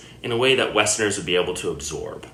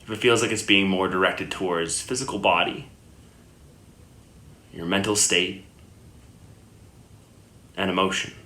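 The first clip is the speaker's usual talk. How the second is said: unhurried meditation guidance with long pauses; guided meditation; 2 semitones lower, 4 dB quieter than usual. Acted. Yoga teacher guiding listeners lying down into Yoga Nidra, calm meditation voice. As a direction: settle the listener gently, leaving long silences between phrases.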